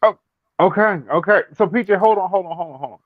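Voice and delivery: high urgent calling. Real, not acted. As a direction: hurry to stop someone. Stopping a friend from leaving.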